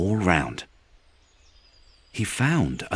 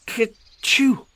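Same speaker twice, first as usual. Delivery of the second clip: high, loud acted sneeze; 5 dB louder than usual. Peter hiding in the can gives himself away, loud sneeze. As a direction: burst out a rabbit's sneeze. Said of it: "Peter sneezed".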